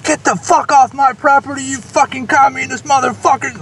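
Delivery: retold angry bellow at the canvassers; furious shout. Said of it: "he starts shouting out the window".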